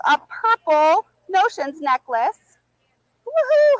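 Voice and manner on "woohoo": excited cheer. Real, not acted. Cheering a giveaway.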